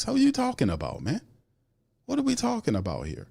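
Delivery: exasperated high-pitched disbelief; incredulous. Real, not acted. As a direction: scoff at the excuse. Rejecting the "we're close" claim.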